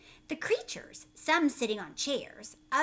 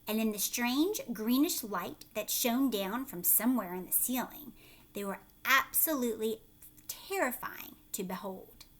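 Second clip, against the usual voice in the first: usual speaking voice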